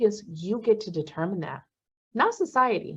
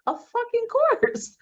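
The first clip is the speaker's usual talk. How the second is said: much higher, slow, laughing through it; amused, high and drawn out; slower than usual. Real, not acted. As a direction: laugh it off as obvious. Laughs at the obvious trauma.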